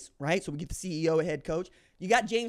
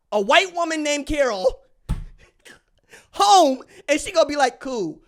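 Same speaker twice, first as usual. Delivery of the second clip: Nick Fury boast; 7 semitones higher, 9 dB louder than usual. Retold or imitated. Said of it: "Robert Redford going".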